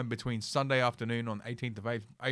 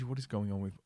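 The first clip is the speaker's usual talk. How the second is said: ordinary talk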